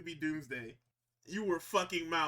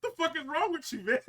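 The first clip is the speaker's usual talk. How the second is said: mock Cole outrage; 11 semitones higher, 4 dB louder than usual.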